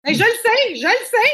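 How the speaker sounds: high, loud eager protest; excited exclaim. Real, not acted. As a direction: burst out, really high and loud, that you already know. Guest admits missing Paris concerts.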